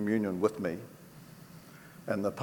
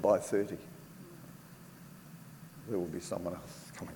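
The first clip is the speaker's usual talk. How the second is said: choked up; 3 semitones lower, 9 dB quieter than usual. Real, not acted. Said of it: "never said this without getting emotional".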